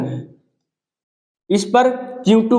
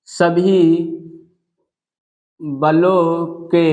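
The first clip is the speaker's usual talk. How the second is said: slow, measured dictation for note-taking; teacher dictating notes slowly; 3 semitones lower, slower than usual. Acted. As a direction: read it out phrase by phrase so students can write.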